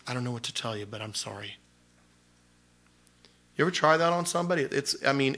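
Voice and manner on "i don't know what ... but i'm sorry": very soft, gentle sorry; soft answer. Shown as a demonstration. Answering the accuser again with a soft gentle apology. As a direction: let it fall quietly, no fight.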